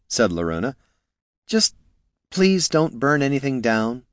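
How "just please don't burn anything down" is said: sighed, higher-pitched plea; weary sigh. A narrator's reading. Helper leaving two feuding mages, sighed plea. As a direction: plead with a touch of resignation. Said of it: "sighed Niln".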